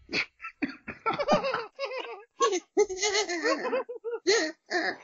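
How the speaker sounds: long loud squeaky laugh; high-pitched laugh. Pretend. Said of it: "Let's do a high-pitched laugh while we vibrate".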